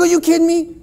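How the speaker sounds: high, loud retold disbelief; amazed disbelief. Soldier amazed to meet his pastor's friend, loud disbelief. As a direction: replay the shock of the coincidence. Retold astonished meeting in Iraq.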